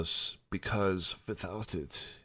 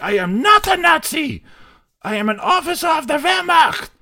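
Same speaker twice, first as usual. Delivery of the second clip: hammed-up, high, shouted German accent; 14 dB louder than usual. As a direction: bellow indignantly like a German officer, hammed up. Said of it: "I'm doing a way better German accent".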